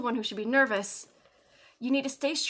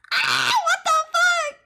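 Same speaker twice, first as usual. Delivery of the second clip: shocked shout; 8 dB louder than usual. Real, not acted. Startled by cute client on screen.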